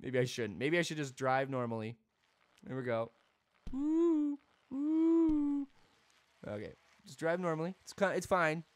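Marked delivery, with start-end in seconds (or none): 3.7-5.7 two long, high woo sounds. Pretend. Imitated noise while riding.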